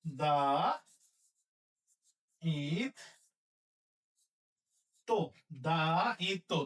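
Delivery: দায়িত্ব broken into slow syllables "da - it - to". Shown as a demonstration. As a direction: spell out দায়িত্ব slowly, one piece at a time. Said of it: "দায়িত্ব কি লিখবো?".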